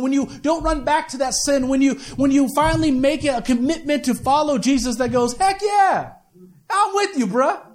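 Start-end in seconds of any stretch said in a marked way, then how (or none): 5.4-7.6 high, pumped-up friend cheering; excited buddy. Retold or imitated. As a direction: hype up a buddy. Voicing an encouraging friend.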